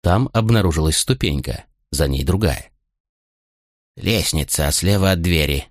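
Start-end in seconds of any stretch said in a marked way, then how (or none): none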